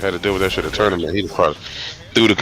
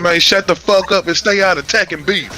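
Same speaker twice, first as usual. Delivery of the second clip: higher mock heckler voice; imitating heckler; 10 semitones higher than usual. Retelling Morty shouting at the crowd, mock heckler voice. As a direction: replay the yell.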